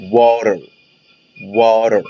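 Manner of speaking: water said as "warer". Shown as a demonstration. Teacher showing second option, water with an R sound. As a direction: soften the T in water to an R-like tap. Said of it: "ممكن أنطقها دي أو آه".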